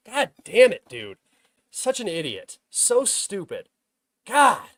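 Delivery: cursing your own stupidity; furious self-reproach, real; angry self-scolding. Real, not acted. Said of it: "I got so mad at myself".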